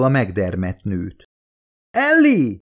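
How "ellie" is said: high, loud cry of her name; sudden shout. A narrator's reading. Charlie pulling Ellie out of the runners' path, loud high cry. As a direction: yell her name in alarm. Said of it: "kiáltott fel Charlie".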